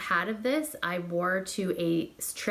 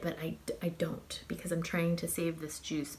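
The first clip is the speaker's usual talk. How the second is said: soft whisper, much quieter; whispered aside; 6 dB quieter than usual. Real, not acted. Reviewer confiding she hoards the fragrance, whispered aside. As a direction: whisper it as a guilty little secret. Explaining why she never sprays it.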